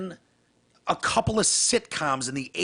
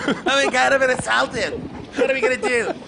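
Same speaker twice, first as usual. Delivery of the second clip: whiny American; 9 semitones higher than usual. Retold or imitated. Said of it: "turns immediately into a whiny American".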